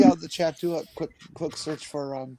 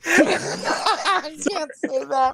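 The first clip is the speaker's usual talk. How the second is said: laughing; 13 semitones higher, much slower than usual. Real, not acted. Laughing, high and slow.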